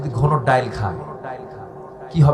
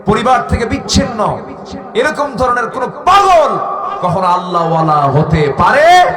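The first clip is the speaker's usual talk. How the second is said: loud, raised-pitch rhetorical challenge; indignant shout; 8 semitones higher, 8 dB louder than usual. Real, not acted. Preacher condemning false holy men to the crowd, indignant shouting. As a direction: hurl the question at the crowd.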